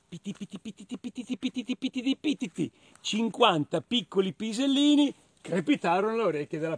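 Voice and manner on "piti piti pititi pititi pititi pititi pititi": rapid patter swelling from soft; pattering pellets. Acted. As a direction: rattle off tiny pattering syllables. Sound of the hare's droppings.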